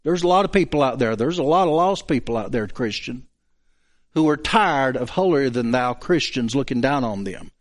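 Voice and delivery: ordinary talk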